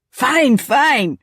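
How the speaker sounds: loud surrender. Acted. Father giving in loudly.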